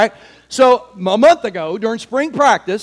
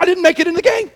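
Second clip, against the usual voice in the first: upset complaint; 6 semitones higher, faster than usual. Acted. Snapping at people after dream.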